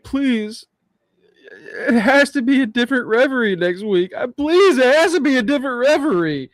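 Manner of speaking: high, pleading mock whine; pleading Blake impression. Co-host mimics Blake begging Ava for a new referee, high pleading whine. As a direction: beg like a desperate wrestler. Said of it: "but she goes".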